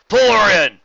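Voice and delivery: very loud, high command; barked order. A narrator's reading. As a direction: yell the order across deck. Steelwing orders crew.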